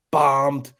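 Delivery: lightly imitated drunk buddy, vowel leaned on; light impersonation, heavy on the "om" in bombed; Rico Bosco. Imitating his drunk friend announcing his state, lightly impersonated. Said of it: "Rico impersonation, too, with the, the accentuation".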